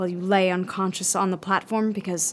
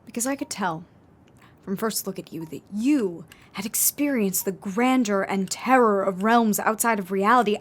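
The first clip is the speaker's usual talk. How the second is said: ordinary delivery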